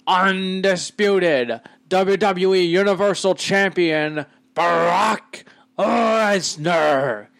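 high, drawn-out ring introduction; Paul Heyman. Retold or imitated. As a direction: hype the champion slowly, stretching the name way out. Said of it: "a Paul Heyman accent".

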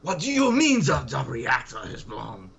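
imitating a stern Russian official; a hint of a Soviet party man's Russian accent; lightly voiced Russian accent, indignant; mock Russian accent. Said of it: "the only person doing a Russian accent".

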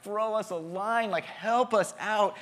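mildly acted cry for help; longing plea. Preacher voicing our plea for peace, longing. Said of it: "Do we long for it?".

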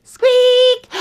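piercingly high, loud squeak; mouse squeak. Acted. Mice try to wake Goldilocks, loud high squeak. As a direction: squeak out one big alarm.

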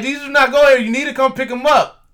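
retold bossy yelling, pressing the point; rude demanding shout. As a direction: imitate a loud, high, insistent order. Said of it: "Is that how you normally talk to people?".

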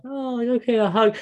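very high, surprised mock-girlish voice; imitating sister. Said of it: "she's just gonna be like".

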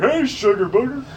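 cartoon dad voice. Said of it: "the dad to that show".

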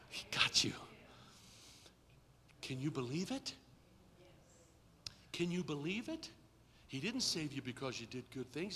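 low, soft, intimate testimony, near whisper at times; hushed preaching. Acted. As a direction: let it sink in quietly with long pauses.

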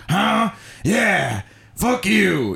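high, screamy, throaty rapper impression; mock-angry scream from deep in the throat. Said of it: "screamier and deeper throated".

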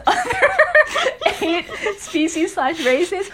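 words squeezed out through giggles; half-laughing question. Real, not acted. Asks while giggling.

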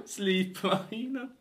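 slow, laughing through the word. Real, not acted. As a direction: giggle out the punchline. Repeating the punchline while laughing.

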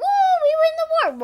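high excited cry; high we win the war; triumphant. Real, not acted. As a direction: celebrate the win. Excited victory.

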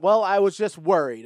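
mock offended lady. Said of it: "she's like".

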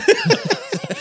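high laughing shriek; pterodactyl shriek. Retold or imitated. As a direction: screech like a pterodactyl. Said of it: "That sounded like a pterodactyl".

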